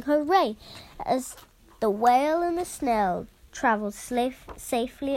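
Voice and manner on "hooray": higher, louder hooray; cheering shout. A narrator's reading. Villagers cheering the whale safely away, loud hooray. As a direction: cheer like a happy crowd. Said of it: "villagers shouting".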